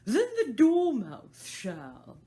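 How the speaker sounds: very loud, slow cry; both crying out. Acted. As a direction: call it out together. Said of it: "they both cried".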